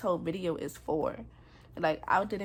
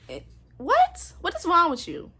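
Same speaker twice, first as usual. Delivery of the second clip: loud, much higher disbelieving yell; outraged shout; 10 semitones higher, 9 dB louder than usual. Real, not acted. Demanding to know what is wrong with him, outraged shout. Outraged at how he treats her.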